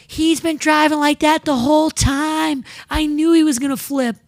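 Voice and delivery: high, loud, drawn-out complaint; imitating a Karen. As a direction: tattle indignantly in a put-on high voice. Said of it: "There was a Karen behind me".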